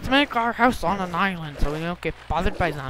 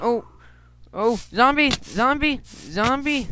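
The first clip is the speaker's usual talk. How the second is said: high, loud zombie alarm; alarmed shout; 8 semitones higher, 7 dB louder, slower than usual. Real, not acted. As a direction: yell a warning, panicked. Spots a zombie attacking.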